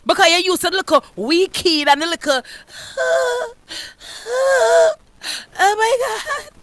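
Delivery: high, hammed-up sighing fret; high fake whimpering sighs; mock weak woman. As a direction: play the fragile damsel.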